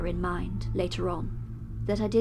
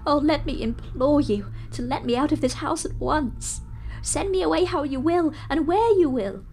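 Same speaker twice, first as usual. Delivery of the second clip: much higher and louder, begging with tears, slowing to a sob at the end; tearful desperate pleading; 11 semitones higher, 10 dB louder than usual. Acted. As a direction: beg for your soul in a torrent of tears. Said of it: "with the tears rolling down his cheeks".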